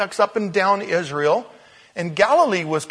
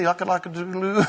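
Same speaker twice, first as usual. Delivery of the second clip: 1 mock rooster crow; faster than usual. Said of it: "I don't know what I said".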